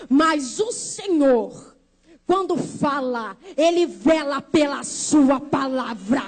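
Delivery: low, very slow preaching; solemn proclamation. Real, not acted. Preacher declaring God keeps his word, solemn. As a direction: proclaim it with weight, stretching each word.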